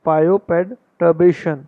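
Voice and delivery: bioperturbation spelled out very slowly, bio-per-tur-bation; broken into parts. Shown as a demonstration. Teacher shows students how he practised the word. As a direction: chunk bioperturbation syllable by syllable. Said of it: "तोड़ तोड़ के पढ़ के ही practice की है".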